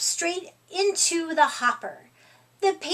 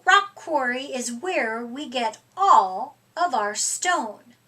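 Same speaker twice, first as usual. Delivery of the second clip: ordinary delivery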